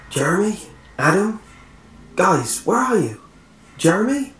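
high, searching calls with rising names; calling out for friends. A narrator's reading. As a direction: call anxiously for your missing friends.

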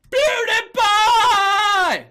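very high, very slow drawn-out scream; a scream long enough to count twice; held shout. Acted. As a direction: stretch it into one long yell. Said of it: "That counts as two".

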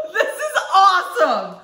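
thrilled by lightsaber chopsticks; delighted. Real, not acted. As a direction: savor the awesome.

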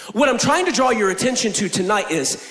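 usual speaking voice

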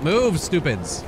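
slow drawn-out exasperated order. Real, not acted. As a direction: drag it out, fed up. Annoyed at crowd in way.

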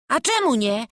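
put-on a boy's sudden mild outcry; sudden exclamation. A narrator's reading. Boy objects to the master's refusal, sudden exclamation. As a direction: burst in with the question. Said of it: "Wykrzyknął nagle Wawrzuś".

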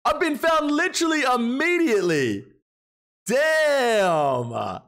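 high, drawn-out cry of surprise; mock dismay at being found. Real, not acted. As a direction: yelp it like you're caught red-handed. Host spotted by players in game.

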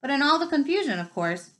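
plain reading voice

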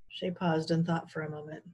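ordinary delivery

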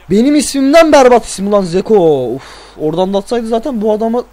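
normal talking voice

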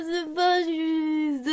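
slow, sung-out crying whine; real tearful whine; whiny sobbing. Real, not acted. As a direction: drag the complaint out in tears. Child sulking and crying.

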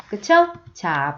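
ordinary talk

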